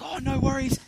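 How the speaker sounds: high, obliging Aussie bloke; eager helper. Retold or imitated. Luke answers Bob's call for the bag, high and eager. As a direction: jump to it cheerfully.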